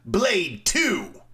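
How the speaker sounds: Blade Two lifted high and proclaimed; mock grand. Mocking the critic, pompously naming the film. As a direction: announce it like a masterpiece.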